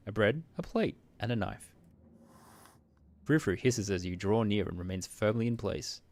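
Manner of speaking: normal talking voice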